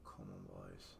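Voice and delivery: quietly will the team on; muttered plea. Real, not acted. Urging his players under his breath, very soft.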